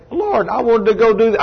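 high, exasperated sigh of a plea; mock frustration. Preacher complaining to the Lord about broken printers, exasperated. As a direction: whine it to the Lord, put out. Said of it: "And I think".

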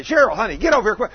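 loud, high holler to come over; screaming. Acted. Husband calling Cheryl over like a workmate, brusque acted yell. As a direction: yell for her to hurry. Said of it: "You screamed at me".

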